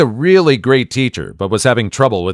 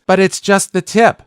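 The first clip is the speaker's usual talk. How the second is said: put-on grinning kid; 8 semitones higher than usual. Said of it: "He grins and then says".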